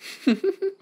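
put-on high-pitched girlish titter; quick, very high chuckle; high giggle. A narrator's reading. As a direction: giggle from under the covers. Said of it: "Amy giggled under her covers".

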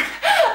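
high bursting laugh; short laugh. Real, not acted. Laughs at own burp.

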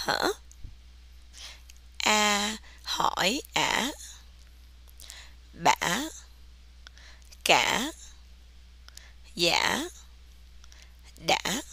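"ả, Bả, Cả" dipping, then swinging up like a question; dip-and-rise tone on "Bả. Cả. Dạ. Đã.". Shown as a demonstration. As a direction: ask each syllable, "Hả? Bả. Cả.", as a question. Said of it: "like you are asking a question".

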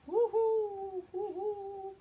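high, loud owl hoot. Acted. Storyteller voices Owl calling to Squirrel. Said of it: "called Owl".

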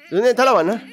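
ordinary talk, nothing marked

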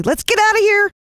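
high, loud panicked cry. A narrator's reading. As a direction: urge escape in panic. Fleeing creature.

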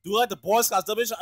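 ordinary delivery

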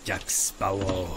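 low, slow gruff pirate. Shown as a demonstration. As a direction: drawl it like a pirate. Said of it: "in a pirate voice".

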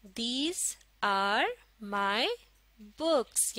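very slow, a pause after each word; word-by-word model reading. Shown as a demonstration. Teacher models a sentence for learners, word by word. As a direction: space out every word for learners.

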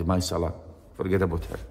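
low, soft decision; praying to himself. Retold or imitated. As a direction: drop the voice as the monk makes up his mind. Monk's quiet decision in prayer.

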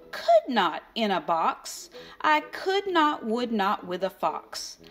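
ordinary delivery

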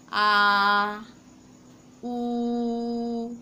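आ and ऊ sustained at length; teacher holding vowels for class; long held vowels. Shown as a demonstration. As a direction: hold each vowel steadily long. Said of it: "हम जितना भी लंबा".

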